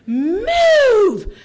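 high, loud one-word scream. Retold or imitated. Blocked driver screaming again, full yell. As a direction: bang out a furious yell. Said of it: "she screamed again".

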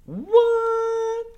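shrieking high What; sudden squeal of disbelief; surprised. Real, not acted. Surprise at the news.

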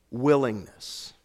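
Willingness drawn out slowly, each syllable weighed; slow stressed echo. Shown as a demonstration. Pastor repeating the theme word to the church, slowly. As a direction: let Willingness sink in, said slowly. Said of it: "about willingness".

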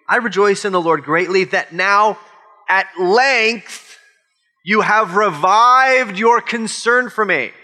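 pointed mock thanks, spaced out for effect; passive-aggressive. Preacher reading Paul's thanks to the church, passive-aggressive. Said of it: "like, a passive-aggressive tone".

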